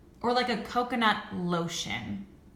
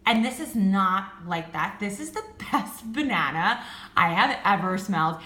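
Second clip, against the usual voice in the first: ordinary talk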